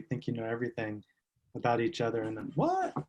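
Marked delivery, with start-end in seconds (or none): 2.5-2.9 very high-pitched, incredulous squeak of a question; mock astonishment. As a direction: act out sudden disbelief, voice leaping up. Acts out mother-daughter surprise.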